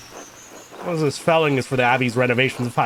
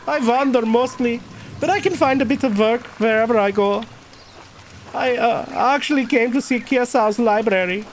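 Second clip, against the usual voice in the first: put-on higher-pitched German-accented character; 11 semitones higher than usual. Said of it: "went for the German accent with this guy".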